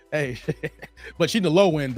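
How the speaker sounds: ordinary delivery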